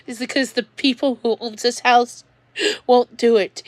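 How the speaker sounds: ordinary delivery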